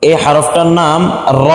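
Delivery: ordinary talk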